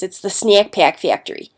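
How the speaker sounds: flattened Cleveland vowels in SNACK PACK FACTORY; Cleveland accent. Shown as a demonstration. Teacher demonstrates a local trait, flattened Cleveland accent. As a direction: do a Cleveland accent, "snyeck pyeck fyectory". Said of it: "the flattening of the vowels".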